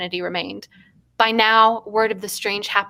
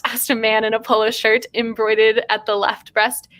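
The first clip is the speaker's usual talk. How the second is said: normal talking voice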